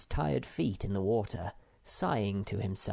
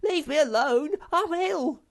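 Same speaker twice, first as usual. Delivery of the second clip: high, louder squealing protest; shrieking complaint while being dragged; whiny shout; 8 dB louder than usual. A narrator's reading. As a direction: squeal it like a sick pet. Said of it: "he shouted".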